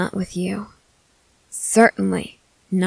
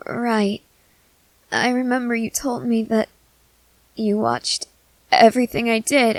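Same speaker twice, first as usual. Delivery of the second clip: put-on nervous young companion; 4 semitones higher than usual. Companion confessing fear of feeding to the vampire, nervous and higher. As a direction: let the worry sit under every line. Companion recalls his watching.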